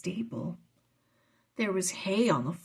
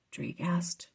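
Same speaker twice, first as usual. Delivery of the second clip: ordinary delivery, nothing marked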